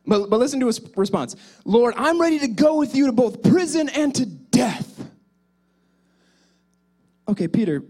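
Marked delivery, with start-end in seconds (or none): 1.6-5.1 bold vow. Acted. Peter's boastful vow acted out.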